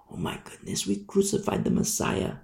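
breathless, horrified whisper; awestruck whisper. Acted. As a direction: whisper it as if realizing a terrible guilt. Israel mourning the crucified Messiah.

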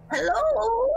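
a little grandmother calling up the stairs, pitched high; high old lady calling hello. Acted. Old woman calling up the stairs from below, high hello. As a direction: call out hello in a high, lightly voiced granny voice. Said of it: "I go".

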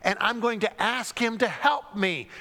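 ordinary talk, nothing marked

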